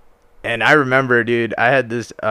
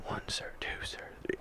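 whispered count; 20 dB quieter than usual. Retold or imitated. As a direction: count in a hushed breath. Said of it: "have to whisper".

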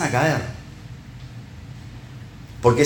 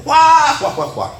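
high, loud hammed-up guffaw "juá, juá"; mock crowd laugh; 6 dB louder, slower than usual. Preacher mimics the congregation laughing at an impression. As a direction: burst out like the whole room laughing. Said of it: "alguien imita a Juan Pablo".

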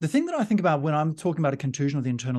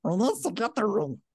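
higher, scheming creature voice; Gollum voice; 6 semitones higher than usual. Acted. Doing Gollum for the podcast audience, scheming creature voice. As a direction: plot it like a cave creature. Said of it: "say anything in a Gollum voice".

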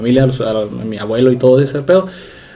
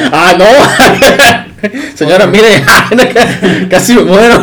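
laughing while mock-pleading, pitch up; laughing mock plea to a lady; 6 semitones higher, much slower than usual. Real, not acted. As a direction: giggle through a fake plea to the señora. Mocking voice through laughter.

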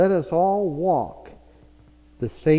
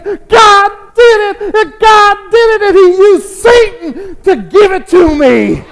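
very high, loud triumphant cry; acted ecstatic widow praising at full voice; jubilant shouting; 15 dB louder than usual. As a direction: belt it out joyfully to the punchline. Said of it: "she started saying".

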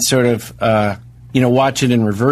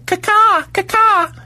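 very high sharp "ka-ka"; high squeaky whistle call; much slower than usual. Acted. Mock tip call to a waiter, very high. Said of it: "I just go".

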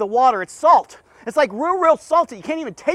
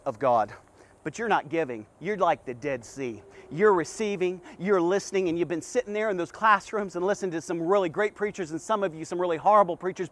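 quiet earnest preaching; 4 semitones lower, 4 dB quieter than usual. Acted. Preacher confronting the congregation, quiet and earnest. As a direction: lower and softer, confiding sermon voice.

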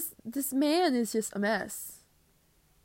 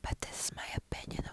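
soft unvoiced whisper, word my leaned on; 12 dB quieter than usual. To listeners, so [name] won't hear, a whisper. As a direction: whisper it like a secret. Hiding from a lawsuit.

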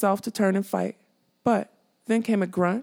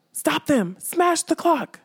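shouting; 8 semitones higher, slower than usual. Acted. King Haggard ordering his men to smash the clock, shouting. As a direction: bellow orders at the guards. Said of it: "King Haggard was shouting".

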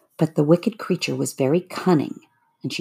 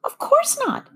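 put-on very high girl's voice; young girl Dorothy. A narrator's reading. Dorothy answers the melting witch in a high girlish voice.

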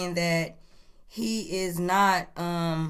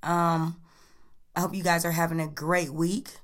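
ordinary talk, nothing marked

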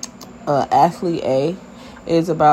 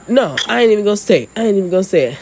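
fast, louder, higher mock protest; retold indignant refusal; 5 semitones higher, 4 dB louder, much faster than usual. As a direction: act out a worked-up dad refusing point-blank. Retold upset dad refusing to repeat.

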